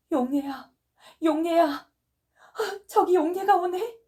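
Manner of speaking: high, crying, loud delirious call. Acted. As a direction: cry out at a vision of your daughter. Delirious mother sees daughter. (환영을 보고 울부짖는 높은 외침)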